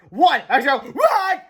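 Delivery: high, loud questioning yelp; startled shouted question; crying out. A narrator's reading. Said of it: "cried George".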